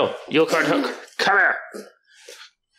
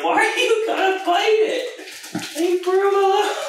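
very high pet-talk scold; high dog-talk voice. Real, not acted. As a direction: squeal it at the dog.

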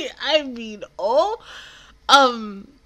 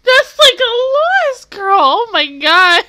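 exasperated outcry; 8 semitones higher, 8 dB louder, slower than usual. Real, not acted. Exasperated reaction.

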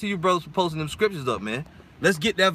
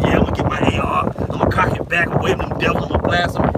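mock hothead; 4 dB louder than usual. Mocks a gun-toting hothead.

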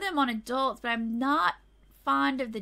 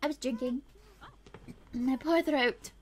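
ordinary delivery

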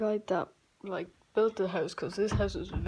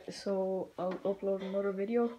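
usual speaking voice

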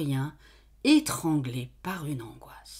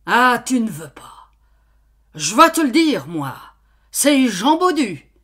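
angry badgering; 6 semitones higher, 11 dB louder than usual. Farmer keeps badgering her.

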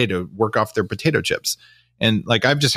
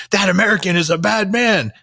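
higher, louder put-on Texas accent; mock Texas accent, raised and louder; 12 semitones higher, 4 dB louder than usual. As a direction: play a scolding Texan in place of a British dietitian, pitched up and loud. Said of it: "I did the Texas one instead".

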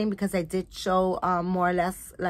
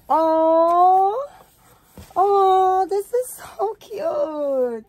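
adoring squeal; 9 semitones higher, 7 dB louder, much slower than usual. Real, not acted. Unboxing baby doll gift.

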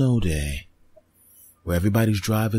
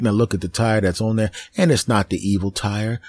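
ordinary talk, nothing marked

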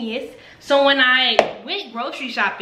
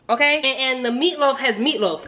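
normal talking voice

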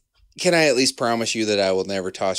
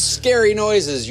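announcer voice; 10 semitones higher, 3 dB louder, slower than usual. Acted. Announcing podcast title.

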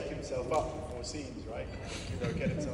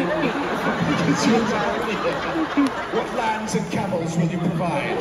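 mock dowry demand; 6 semitones higher, 7 dB louder, slower than usual. Mock suitor asking for dowry.

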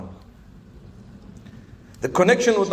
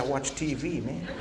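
mock American accent; 4 semitones lower, 9 dB quieter than usual. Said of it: "She said".

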